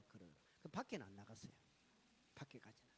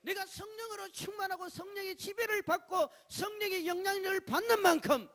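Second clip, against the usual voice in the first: much higher, much louder sermon climb; preacher crying out at full force; full-force preaching; 13 semitones higher, 17 dB louder than usual. Acted. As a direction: build the fire of the sermon.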